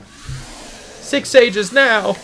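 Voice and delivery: drawn out high. Shown as a demonstration. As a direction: stretch it out up high. Reading game text, first take.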